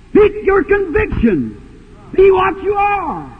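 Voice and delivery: high, forceful exhortation; preacher's shout. Real, not acted. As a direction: cry it out to the congregation. Exhorting congregation, shouted climax.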